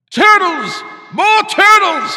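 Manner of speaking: very high, very loud, slow bellow; bellowing shout. A narrator's reading. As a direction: bray it across the pond. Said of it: "he bellowed and brayed".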